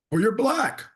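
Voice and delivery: imitated high-pitched blunt statement; matter-of-fact. Older clerk answering the man matching his skin, matter-of-fact. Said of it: "he says it so matter-of-factly".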